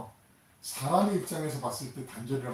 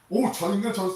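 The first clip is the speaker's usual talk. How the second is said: loud, higher mock curse; mock cursing; 7 semitones higher, 7 dB louder than usual. Peter cursing at the crowd, mock angry shout. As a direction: spit it out like an angry man cursing. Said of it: "막 하면서 엑스엑스 막 이러면서".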